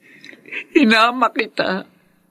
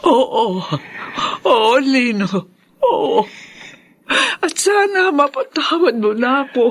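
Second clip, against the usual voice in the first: tearful yes, rising higher through the lines; crying acceptance; 4 semitones higher than usual. Acted. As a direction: weep out the yes with relief. Mother-in-law forgiven, weeping.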